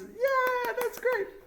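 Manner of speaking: very high, cheerful mock voices; mock cheering crowd. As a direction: squeal it like a delighted audience.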